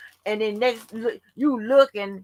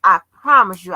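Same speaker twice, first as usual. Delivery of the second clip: loud, emphatic promise; loud insistence; 11 dB louder than usual. Retold or imitated. To the man watching her, a loud insistent promise. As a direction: swear it loudly to him.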